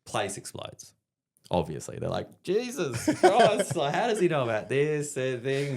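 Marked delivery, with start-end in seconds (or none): 2.4-5.8 high, slow, laughing "Jesus Christ" as the stunned crowd; a crowd gasping in disbelief, laughing as it goes; shocked outcry. Retold or imitated.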